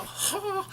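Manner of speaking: a sharp, very high laughing shriek; one burst of wild laughter; hysterical laugh. Retold or imitated. Mitchell laughing hysterically, retold.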